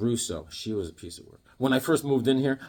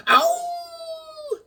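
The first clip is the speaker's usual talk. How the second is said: long, high, held wolf howl; playful high "awoo" held on one note. Pretend. Fan cheering Man Wolf, playful wolf howl.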